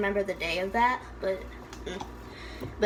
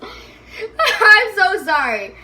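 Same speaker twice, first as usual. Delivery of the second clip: real laughing apology, pitched up and loud; 9 semitones higher, 9 dB louder, much slower than usual. Real, not acted. Vlogger laughing at her own slip, apologizing while laughing.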